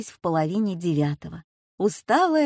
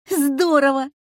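bright, loud burst of glee; delighted exclaim; 7 semitones higher, 6 dB louder than usual. A narrator's reading. Girl laughing at grandma's new nickname, loud delight. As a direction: shout it like the best joke you heard. Dasha delighted by funny name.

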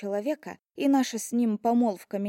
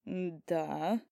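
drawn out; 4 semitones lower, 6 dB quieter than usual. A narrator's reading. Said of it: "протянул он".

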